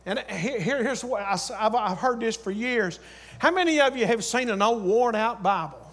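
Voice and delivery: normal talking voice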